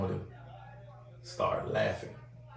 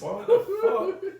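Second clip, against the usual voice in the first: stunned; much slower than usual. Retold or imitated. John's stunned reaction.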